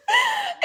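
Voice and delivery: a short high laugh at herself; high giggle. Real, not acted. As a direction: giggle at your own joke. Laughs at her own sign.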